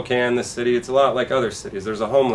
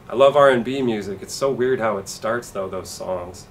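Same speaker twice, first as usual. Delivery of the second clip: normal talking voice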